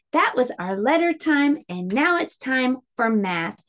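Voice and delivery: ordinary talk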